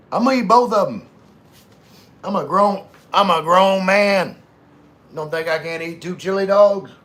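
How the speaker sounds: high, booming self-defense; big, emphatic protest; loud indignation. Real, not acted. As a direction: proclaim it loudly, as if your appetite was doubted. Indignant reply to who eats both.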